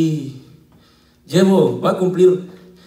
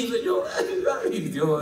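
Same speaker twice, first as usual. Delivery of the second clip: mock whimpering; 6 semitones higher, 5 dB quieter than usual. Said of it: "porque me mire chillando a mí".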